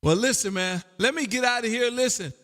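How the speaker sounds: normal talking voice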